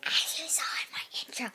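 hushed whispered greeting; soft unvoiced whisper to the viewers. Real, not acted. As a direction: whisper it like a secret intro. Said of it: "why am I whispering?".

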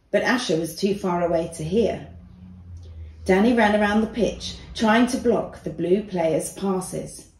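plain reading voice

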